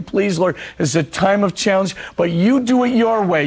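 ordinary talk

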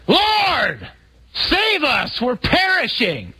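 high, drawn-out desperate yell; panicked high-pitched shout; yelling over storm. Acted. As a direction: call out to the far end of a boat in a gale. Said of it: "one of us yells to the back".

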